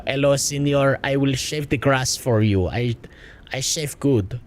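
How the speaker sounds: put-on Spanish-tinged accent, passed off as American; mock immigrant accent. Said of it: "good, strong American accent".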